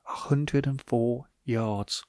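slow, hushed awe at "A hundred and four yards"; drawn-out quiet marvel at "A hundred and four yards"; slow and soft. Shown as a demonstration. Repeats stat slowly for effect.

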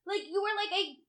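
high, loud, comic outrage; worked-up mock tirade; exasperated rant. Exasperated rant at shark swimmers.

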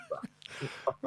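ordinary talk

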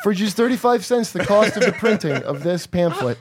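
mock religious pamphlet pitch; door-knocker pitch.

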